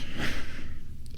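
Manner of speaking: a soft, breathy laugh that catches in the throat; half-laughing to hold back the tears; teary laugh. Real, not acted. Remembering his late dog Braddy, a teary laugh. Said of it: "I'm getting emotional already".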